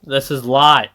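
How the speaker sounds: loud excited yell; screaming. Real, not acted. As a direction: shout it out at full volume. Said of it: "I probably shouldn't scream".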